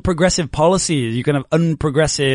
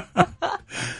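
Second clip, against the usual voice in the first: laughing; 8 dB quieter than usual. Real, not acted. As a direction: burst of high laughter. Teacher laughs mid word.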